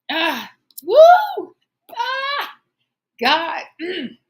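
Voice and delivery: high, loud, breaking cries between laughs; real tears, laughed away; crying, laughing it off. Real, not acted. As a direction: let the sob and the laugh fight each other. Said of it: "you don't wanna cry".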